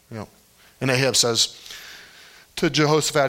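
normal talking voice

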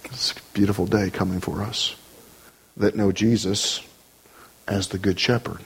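low, softened pulpit voice; hushed, comforting preacher; tender reflection. Real, not acted. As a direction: drop down low and reassure them. Tender reflection after preached climax.

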